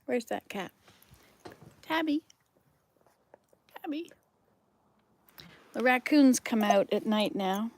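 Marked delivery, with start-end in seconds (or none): 1.8-2.2 high sing-song call to a cat. Real, not acted. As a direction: lilt the name up to coax the cat out. Calling the cat by name.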